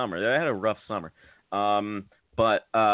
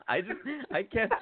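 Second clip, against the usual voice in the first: laughing; 11 semitones higher, 4 dB quieter than usual. Real, not acted. Laughing while speaking.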